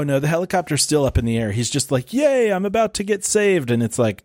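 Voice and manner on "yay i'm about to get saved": mock joy. Last guy about to be saved by helicopter, mock joyful. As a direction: lightly act out happy relief. Acts man about to be rescued.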